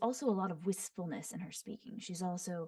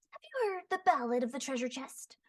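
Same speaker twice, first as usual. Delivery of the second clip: higher, sing-song bard voice; Venti's voice; 6 semitones higher than usual. Shown as a demonstration. Voice actor demoing a bard inviting a listener, sing-song and higher. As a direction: tease it out like a playful bard. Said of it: "nasal, higher-pitched, pushed forward".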